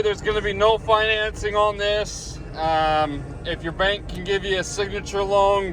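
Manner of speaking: ordinary talk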